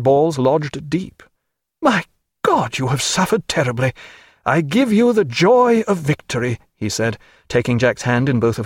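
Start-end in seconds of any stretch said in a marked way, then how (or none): 1.8-6.6 higher, eager merchant captain voice; a jovial captain gushing over the battle; Captain Muffet, triumphant. Acted. Muffet comes aboard and greets Jack, higher eager voice.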